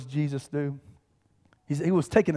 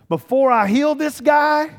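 slow, loud and much higher declaration; loud and clear; 8 semitones higher, 6 dB louder, slower than usual. Retold or imitated. Jesus declaring who he is before healing, loud and slow. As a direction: proclaim it with force. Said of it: "Loud and clear".

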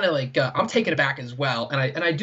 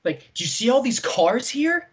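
mock disbelief at the man; incredulous; 8 semitones higher than usual. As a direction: say it like it's obvious, voice rising. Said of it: "And I'm like".

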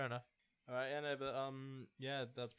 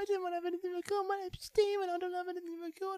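very high whiny mimicry; mocking imitation; 8 dB louder than usual. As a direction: mimic him in a squeaky voice. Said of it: "that's Steven's fault".

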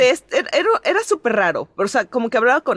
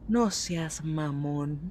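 much lower, softer, very slow; mortified mumble; 7 semitones lower, 10 dB quieter, much slower than usual. Retold or imitated. Caught by the teacher, a dead quiet mumble. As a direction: let it die in embarrassment. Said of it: "Y yo así de".

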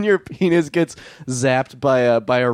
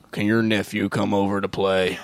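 cowboy; 4 semitones lower than usual. Acted. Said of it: "a cowboy showing up to your door and be like".

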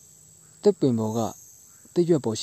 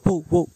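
dog barking; 5 semitones higher, 3 dB louder than usual. Acted. Dog barks in animal story.

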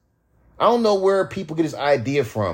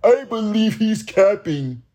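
slow, higher sneering doubter; mocking doubters; 7 semitones higher, slower than usual. Rapper mimicking fans who doubt his story. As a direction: drawl it like a smug skeptic.

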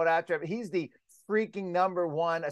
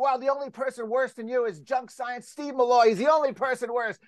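high, indignant mock tirade, pitched way up; hammed-up furious reporter, much higher; retold outraged rant; 9 semitones higher, 3 dB louder than usual. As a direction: imitate him ranting at you in disbelief, voice jumped high. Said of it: "she heard this guy screaming at me".

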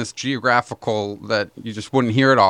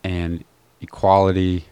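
put-on Democrat accent; 5 semitones lower, slower than usual. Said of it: "the Democrat accent would be".

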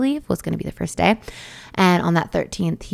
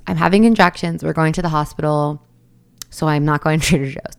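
ordinary delivery

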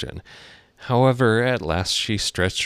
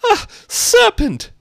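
piercing pigeon voice, rising and louder; shriek; 8 dB louder than usual. Acted. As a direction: hysterical high-pitched rant. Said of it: "rising its voice to a shriek".

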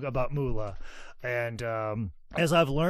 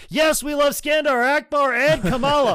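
screaming crowd chant, much higher and louder; mock fan shout; 13 semitones higher, 10 dB louder than usual. Fans cheering the heel instead of pitying him, screamed. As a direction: yell it like a rabid wrestling fan.

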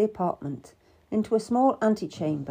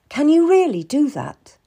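loud, high, pressing question; demanding; 8 semitones higher, 9 dB louder than usual. A narrator's reading. Cat tests Seymour's promise, demanding. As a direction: press hard for an answer. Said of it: "I demanded".

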